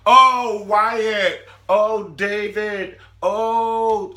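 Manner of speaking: very loud, high hammed-up moaning; mock ecstatic cries.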